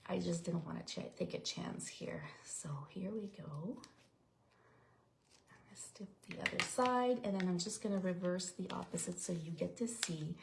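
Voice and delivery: hushed whisper while concentrating. Real, not acted. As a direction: drop to a breathy whisper. Whispering while cutting carefully.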